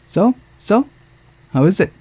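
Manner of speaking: high, excited so so; eager question. Acted. Actress rushing back to ask the director, high and eager.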